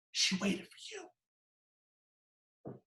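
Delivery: very slow, high, hushed retold revelation; overcome with emotion. Daughter telling visitor her mother waited, overcome with emotion.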